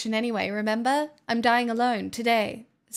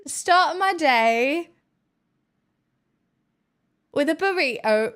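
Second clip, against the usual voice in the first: "Start my day" stretched slow, a little higher and louder; slow test; 3 semitones higher, 3 dB louder than usual. Shown as a demonstration. Testing British wording on chat, slow and deliberate. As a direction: try the phrase out word by word. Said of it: "testing the phrase aloud".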